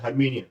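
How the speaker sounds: हर्मिनियन with the T dropped; silent T. Shown as a demonstration. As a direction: say हर्मिनियन with an N sound, no T. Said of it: "टी silent हो गया".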